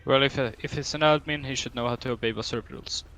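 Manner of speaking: ordinary talk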